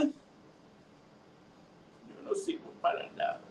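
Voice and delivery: barely voiced, very soft despair; storyteller's weeping pot, fading to breath; tearful. A narrator's reading. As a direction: give up in a tiny hushed sob-voice. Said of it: "¿por qué estás llorando?".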